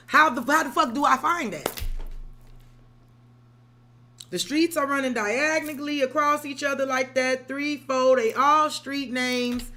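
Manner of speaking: loud exasperated complaint; exasperated rant. Real, not acted. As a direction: rant like the map personally wronged you. Exasperated rant about street layout.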